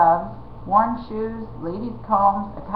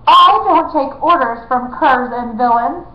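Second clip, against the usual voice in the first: proud, loud royal refusal; haughty; 5 semitones higher, 7 dB louder than usual. Acted. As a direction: look down your nose at them. Prince refuses outlaw.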